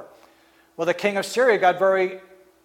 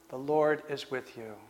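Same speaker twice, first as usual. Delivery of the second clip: much softer, lower and almost to himself; hushed echo; 5 semitones lower, 12 dB quieter than usual. Acted. Preacher repeating the angel's words to the congregation, hushed. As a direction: let the words sink in, barely spoken.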